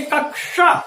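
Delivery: कक्षा said as "kak-sha"; कक्षा with retroflex sh; demonstrated pronunciation. Shown as a demonstration. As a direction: separate the k and sh in कक्षा. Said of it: "जैसे हम बोलेंगे".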